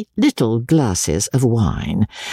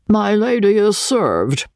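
pompous butler; 11 semitones higher, slower than usual. A narrator's reading. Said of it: "said the butler".

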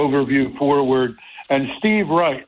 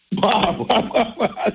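high mock blah-blah nagging; 7 semitones higher than usual. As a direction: imitate a nagging wife, higher. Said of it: "She's going blah".

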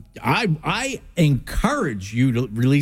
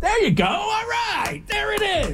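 excited call. Real, not acted. Timing the drill, calling out.

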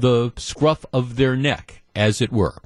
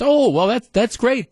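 pleased surprise; 10 semitones higher than usual. Retold or imitated. Reacting to recovered assets yesterday.

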